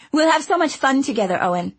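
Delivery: high, happy and eager; delighted. A narrator's reading. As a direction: bubble over with delight. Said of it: "twisting her hands together in delight".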